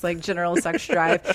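normal talking voice

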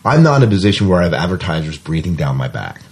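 usual speaking voice

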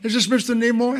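a hint of a breathless young fan; young female fan. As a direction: ask it like a hopeful girl calling a star. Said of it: "a young female voice said".

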